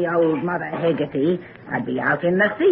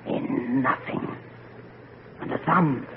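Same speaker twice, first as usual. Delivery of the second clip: hushed; 3 semitones lower, 7 dB quieter than usual. Acted. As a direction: drop it to a breath. Ominous palm reading.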